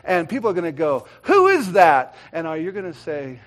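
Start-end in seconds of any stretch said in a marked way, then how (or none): 1.2-2.1 loud, higher-pitched mock astonishment; acted disbelief. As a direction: ask like a scandalised onlooker. Acting out disapproving onlookers.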